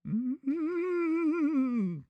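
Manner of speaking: high, drawn-out moaning hum. Retold or imitated. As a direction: hum it like an old lady savouring food. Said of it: "I hear".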